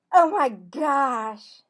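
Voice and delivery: loud, slow, high shock; shocked gasp. Acted. Cassie seeing Marvin reach his elbow, loud shock. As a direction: catch him in the act.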